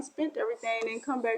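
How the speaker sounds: ordinary talk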